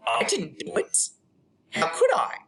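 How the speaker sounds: high, theatrical protest; mock indignant denial. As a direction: plead innocence with squeaky outrage. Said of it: "So, here's my monologue".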